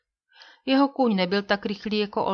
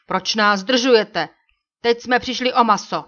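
loud, indignant chief's protest; slow loud demand rising into angry complaint; angry shout; 3 semitones higher, 9 dB louder than usual. A narrator's reading. Chief rebukes the men who spoiled his hunt, shouting angrily. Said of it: "náčelník rozhněvaně vzkřikl".

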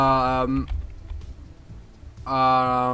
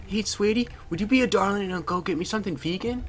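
put-on high-pitched girly character with a silly accent; stupid accent; 7 semitones higher than usual. Girl asking boyfriend for vegan food, silly high accent. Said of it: "doing that with a stupid accent".

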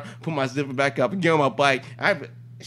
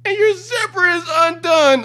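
loud, high, slow mock bawl; crying; 8 dB louder, slower than usual. Crying driver looking up at the cop's zipper, loud mock bawling. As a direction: blurt it through a hammed-up wail. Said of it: "And she's crying".

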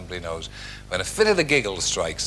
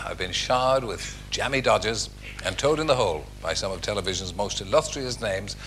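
normal talking voice

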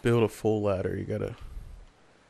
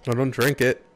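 urgent warning; 2 semitones higher, 6 dB louder than usual. Real, not acted. Warning a game character urgently.

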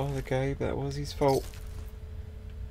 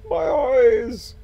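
loud, high wail of my eyes; dazzled cry; 9 semitones higher, 9 dB louder than usual. Real, not acted. Player blinded by a bright light, wailing loud.

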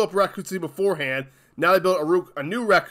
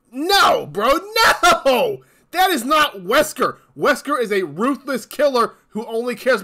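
higher, louder cry of protest; exasperated outburst; 7 semitones higher, 4 dB louder than usual. Real, not acted. Fan ranting at the show's Wesker, shouting in protest. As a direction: shout it out in disbelief.

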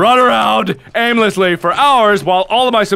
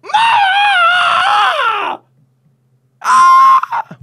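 high drawn-out screams of dismay; frustrated shouting; 3 dB louder, much slower than usual. Real, not acted. Player dying mid-fight, frustrated shouting. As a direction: howl it as it all goes wrong.

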